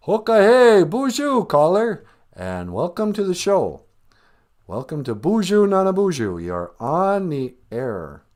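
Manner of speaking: big, drawn-out show welcome; radio host greeting. Acted. Host welcoming a caller to the show, announcer style.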